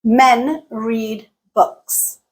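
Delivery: MEN, READ and BOOKS drawn out slowly, evenly spaced; three slow, stressed beats on Men, read, books; stressed beats only. Shown as a demonstration. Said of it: "Listen to the duration of each utterance".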